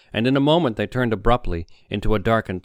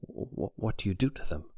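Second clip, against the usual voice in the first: stammering fear, softer; 8 dB quieter than usual. A narrator's reading.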